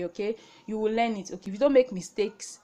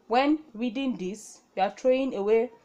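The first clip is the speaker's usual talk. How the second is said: ordinary delivery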